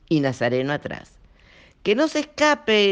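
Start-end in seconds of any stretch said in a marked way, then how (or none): 1.8-2.9 high, loud cry; shout. A narrator's reading. Candelaria shouting to stop Lucas escaping. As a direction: shriek out the order. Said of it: "gritó Candelaria".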